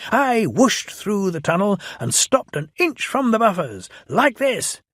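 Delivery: boasting. Acted. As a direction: brag like a proud little engine. Thomas boasts.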